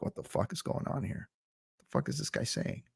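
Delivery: hushed, whispered disbelief; baffled whisper. Retold or imitated. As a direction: whisper it like a bewildered viewer. Baffled aside at the slow YouTuber.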